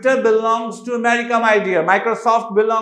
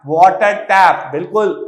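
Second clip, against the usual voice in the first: ordinary talk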